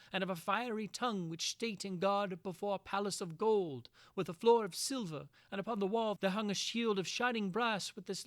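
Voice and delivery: high, loud, ringing storyteller's voice; old romance reading, heightened. A narrator's reading. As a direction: declaim the ancient tale like a bard. Narrator reads the old romance.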